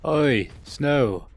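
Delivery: loud call across the room. Real, not acted. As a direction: shout a name to stop someone. Calling a wandering player back.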